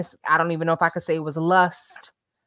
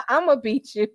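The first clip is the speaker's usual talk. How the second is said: higher, helpless with laughter; laughing mock threat; 10 semitones higher, 6 dB louder than usual. Real, not acted. To the joking commenter, a threat through laughter. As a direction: threaten playfully through giggles.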